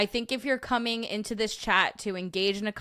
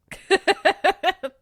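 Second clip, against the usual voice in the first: high, loud run of laughter; big laugh; 12 semitones higher, 8 dB louder than usual. Real, not acted. Laughing at chat's long-distance romance joke, big laugh.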